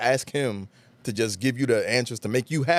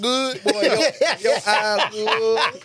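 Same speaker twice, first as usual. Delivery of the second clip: put-on Southern accent; 9 semitones higher, 5 dB louder than usual. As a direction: stretch it out in a mock Southern voice. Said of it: "with Southern accent saying".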